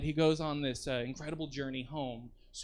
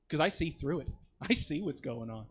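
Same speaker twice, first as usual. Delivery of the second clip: ordinary delivery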